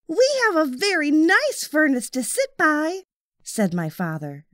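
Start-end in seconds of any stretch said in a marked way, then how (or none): 0.1-3.0 put-on high, eager young boy; a young boy's high voice; little boy voice. A narrator's reading. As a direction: voice the small boy kindly. Boy's voice in story.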